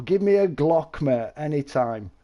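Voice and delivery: usual speaking voice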